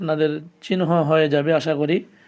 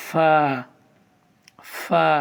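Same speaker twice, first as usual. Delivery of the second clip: normal talking voice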